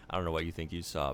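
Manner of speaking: ordinary delivery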